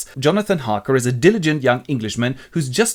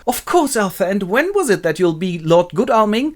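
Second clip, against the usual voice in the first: high, put-on lady's voice; delighted young lady; 7 semitones higher, 3 dB louder than usual. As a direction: accept in an eager high voice. Lucy accepts, acted.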